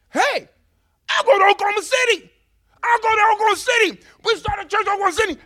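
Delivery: high, fast, full-volume yell; excited shouting. Retold or imitated. Young believer volunteering to friends in the car, excited shouting. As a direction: burst out with it, volunteering at top pitch.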